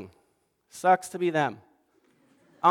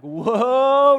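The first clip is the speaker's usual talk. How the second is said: loud, high shout of surprise from the listeners; a startled listener calls out to the preacher, a loud high whoa; 6 semitones higher, 8 dB louder than usual. Retold or imitated. Jolt back in surprise. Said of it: "some of you are like".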